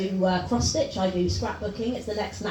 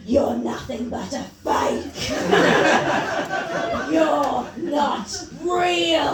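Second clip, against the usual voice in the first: slow, loud mock yell of a child; mock child screaming; 5 semitones higher, 6 dB louder, much slower than usual. Heckling seven-year-olds, mock slow loud yell. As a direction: drag it out like a jeering kid. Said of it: "seven-year-olds screaming at you".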